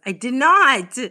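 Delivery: loud, offended protest; a loud, indignant "no I didn't"; indignant denial. Real, not acted. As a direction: shout back that you didn't. Denies sneezing, protesting.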